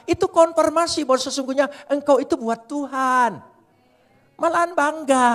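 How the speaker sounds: loud, high preaching voice; fiery preaching. Acted. Preacher exhorting the church to bear insults, at full force. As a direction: drive it home from the pulpit.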